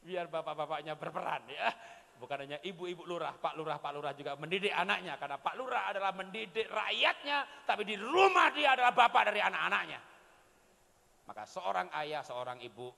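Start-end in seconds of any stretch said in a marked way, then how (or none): none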